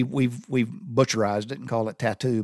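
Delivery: normal talking voice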